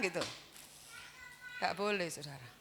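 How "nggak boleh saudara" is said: ordinary talk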